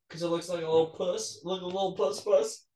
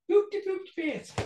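squeaky high helium-pitched voice; 10 semitones higher than usual. Pretend. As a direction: talk after sucking helium. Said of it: "suck in helium and do what".

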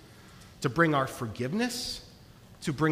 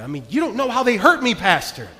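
imagined angry listener; 4 semitones higher, 5 dB louder than usual. Retold or imitated. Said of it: "you might even be a little bit angered".

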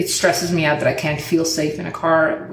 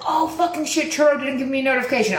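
higher and rushed, imitating a flustered owner; flustered car owner; 6 semitones higher, faster than usual. Car owner admits the app failed, quick higher mock panic. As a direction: blurt it out fast like the caught-out guy. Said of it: "He's like".

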